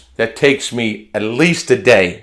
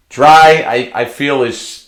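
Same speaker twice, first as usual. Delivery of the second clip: normal talking voice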